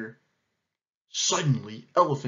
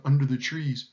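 ordinary delivery, nothing marked

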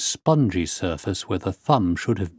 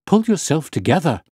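raised, high-pitched order; urgent cry; 7 semitones higher, 4 dB louder than usual. A narrator's reading. Doctor orders the hysterical patient to calm down, crying out. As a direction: firmly call someone back to their senses. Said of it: "I cried".

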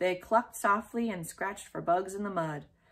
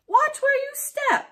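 much higher, loud yell; 8 dB louder than usual. A narrator's reading. Neighbor yelling at girl in flower bed, loud. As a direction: yell at the girl trampling plants. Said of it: "yelled Mister Gruffalo".

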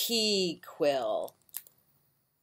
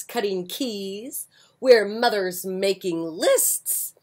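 lively picture-book read-aloud; bright storytime reading; reading to children; 3 semitones higher, 6 dB louder, slower than usual. A narrator's reading.

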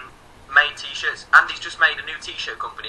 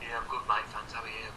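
normal talking voice

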